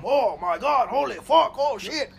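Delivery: panicked shouting in a bad German accent, high and loud. German chef panicking over a choking cook, shouted in a bad German accent. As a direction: yell it like a freaked-out German chef, accent hammed up. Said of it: "That's the worst German accent I've ever heard".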